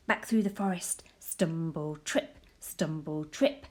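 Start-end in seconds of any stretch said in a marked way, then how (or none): none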